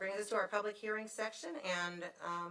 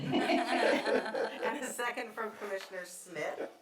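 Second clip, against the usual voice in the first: laughing; 2 semitones higher, 5 dB louder, much slower than usual. Real, not acted. Chair laughs reading the names.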